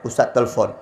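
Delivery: ordinary talk, nothing marked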